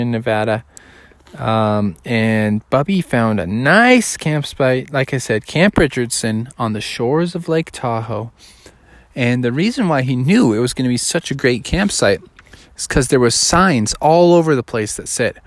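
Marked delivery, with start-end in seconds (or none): none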